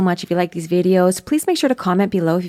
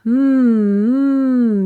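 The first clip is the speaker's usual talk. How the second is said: louder hum through the nose; nasal hum; 5 semitones higher, 5 dB louder than usual. Shown as a demonstration. Coach humming so students feel air at the nose. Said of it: "put your finger underneath your nose".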